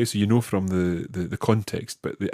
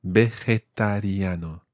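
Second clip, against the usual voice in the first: Vegetariano said slowly, every syllable clear; slow and careful; slower than usual. Shown as a demonstration. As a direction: stretch out Vegetariano for the learner. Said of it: "Try that syllable by syllable".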